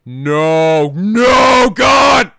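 loud horrified shout. Real, not acted. Reactor yelling at the screen in horror. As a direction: yell in disgust at the screen. Reacting to gross soda drinking.

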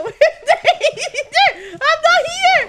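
loud wail drawn out through laughter; slow, loud panicky cry; laughing panic. Real, not acted. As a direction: wail in danger, half laughing. Panicking while chased.

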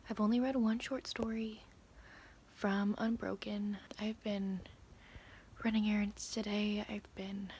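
soft late-night whisper. Real, not acted. Vlogger whispering at night while others sleep. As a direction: keep it hushed so no one wakes. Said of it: "I'm keeping my voice down because people are asleep".

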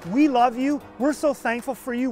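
ordinary talk

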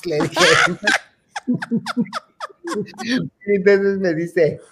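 ordinary delivery

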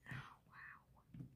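awed whispered wow; breathless whisper. Real, not acted. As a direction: barely breathe it out, stunned. Breathless awe at the masks.

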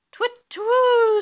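high, loud, long hoot; owl hoot. A narrator's reading. Owl in the garden at night, high hooting.

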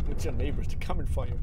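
loud mock Indian accent; teasing in a loud Indian accent. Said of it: "It's really the full Indian accent".

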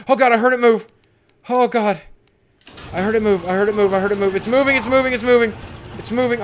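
loud, high, repetitive panic; alarmed. Real, not acted. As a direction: repeat it urgently as fear climbs. Hears monster move.

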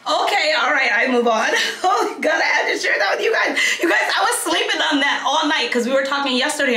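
high laughing outburst. Real, not acted. Vlogger laughing to viewers after telling the snake story, high and excited. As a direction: laugh it off, still buzzing.

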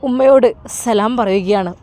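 ordinary delivery

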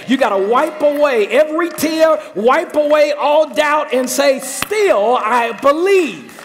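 rising, shouting sermon climax; preaching at full force. Real, not acted. Preacher urging the Jairuses in the room, building to a shout. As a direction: build it to a roaring call to believe.

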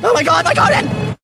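slow, high howl of horror over the garden; anguished cry. Acted. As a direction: cry out as if watching it die. Discovers garden in danger.

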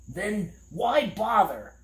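high, drawn-out puzzled question; watchdog character voice. A narrator's reading. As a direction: ask slowly, pitched well up. Tok questions the angry man.

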